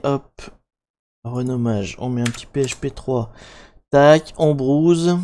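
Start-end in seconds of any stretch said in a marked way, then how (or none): 4.4-5.2 French speaker's proud English accent; drawn-out word in homemade English accent; English accent, slowly and loudly savoured; lovely English accent. Real, not acted. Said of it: "Avec mon bel accent anglais".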